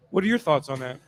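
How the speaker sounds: ordinary talk, nothing marked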